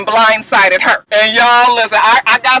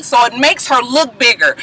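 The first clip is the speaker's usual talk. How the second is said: normal talking voice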